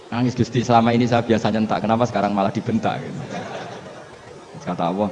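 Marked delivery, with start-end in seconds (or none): none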